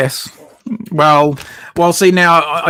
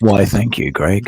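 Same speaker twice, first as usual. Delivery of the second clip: low, sultry thank-you; sexy voice; 6 semitones lower, slower than usual. Acted. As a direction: purr it out seductively. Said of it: "the sexy voice".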